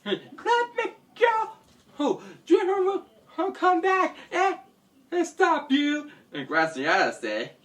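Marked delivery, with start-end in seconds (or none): 0.0-6.1 very high, whimpering protest; captive's desperate plea. Acted. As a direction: plead in a tiny high voice. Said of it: "And Aster said".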